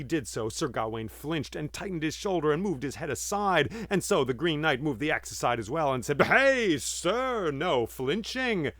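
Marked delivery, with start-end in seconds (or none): 6.2-8.7 loud, high hey, then slow no flinching; scolding. Acted. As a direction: stop him short and scold. Green Knight scolds flinching Gawain.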